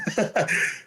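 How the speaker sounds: high, short burst of laughter; a quick amused laugh. Real, not acted. Laugh after story.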